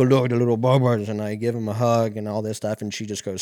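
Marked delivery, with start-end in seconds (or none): 0.0-1.1 loud gushing greeting to a dog; playful retold coo, louder than his chat; puppy talk. Man going over to greet a stranger's dog, gushing.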